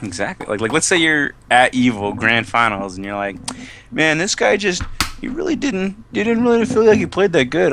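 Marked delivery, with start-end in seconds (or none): 3.9-7.7 higher, mock-bewildered grumble; doubting winner. Imagined Evo winner doubting the victory, mock bewilderment. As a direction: play a winner who can't accept it.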